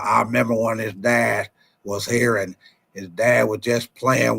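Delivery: put-on low, halting old owner voice; a deep, stumbling mock press-conference owner; Jerry Jones impression. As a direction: talk like a rambling billionaire at a press conference. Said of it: "That's your boy Jerry Jones when he be talking at the press conference".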